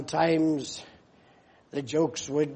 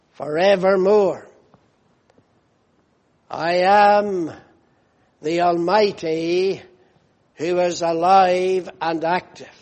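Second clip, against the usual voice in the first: booming pulpit proclamation, words of Christ; preaching; 3 semitones higher, 8 dB louder than usual. Acted. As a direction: proclaim it from the pulpit with full force.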